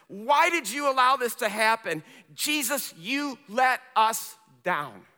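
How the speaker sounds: loud, high, forceful preacher voice; impassioned preaching. Acted. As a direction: preach the hurt as an anguished accusation.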